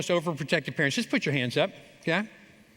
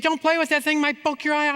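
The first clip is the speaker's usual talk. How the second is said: much higher, loud mock mother's warning; shrieking mom imitation; 10 semitones higher, 4 dB louder than usual. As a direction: play the worried mother, pitched way up.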